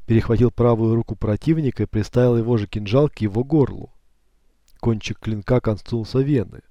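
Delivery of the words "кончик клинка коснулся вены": plain reading voice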